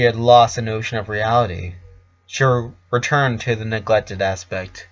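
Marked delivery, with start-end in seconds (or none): none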